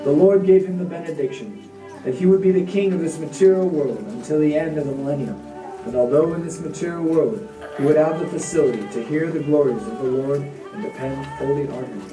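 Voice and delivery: ordinary delivery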